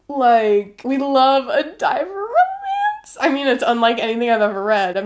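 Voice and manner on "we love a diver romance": high, slow gush. Real, not acted. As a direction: gush over it like a fan. Gushing over book gift.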